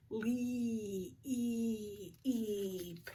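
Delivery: "Le-, e-, eep" with a long ee held in pulses; slow sounding-out. Shown as a demonstration. Teacher checking leap for a long E, slowly sounded out. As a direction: stretch leap out softly on its vowel. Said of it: "Let's say it slow and check".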